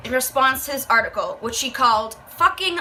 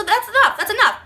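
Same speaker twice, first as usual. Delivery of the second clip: exasperated; 9 semitones higher, 3 dB louder than usual. Retold or imitated. Mocking the mother's answer.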